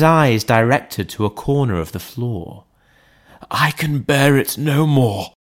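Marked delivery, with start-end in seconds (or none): 3.5-5.3 lightly voiced butler, repeating it a touch higher; repeating the plea. A narrator's reading. Butler repeating his plea to the lawyer, strained voice. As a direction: say it again, worn down and stuck. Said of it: "he repeated".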